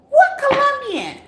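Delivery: shrieking what the hell, way up high; incredulous high squeal; high-pitched shriek. Real, not acted. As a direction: squeal it in disbelief. Baffled by viewer's question.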